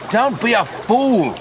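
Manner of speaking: high, slow, insistent call; urgent protest. A narrator's reading. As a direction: cry out to talk a friend out of it. Urging friend amid danger.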